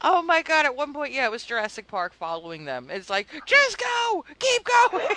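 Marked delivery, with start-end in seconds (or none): 3.5-5.1 high, loud cry to keep running; frantic mock escape shout; urgent shouting. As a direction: yell it like something is chasing them. Acting out panicked flight from dinosaurs.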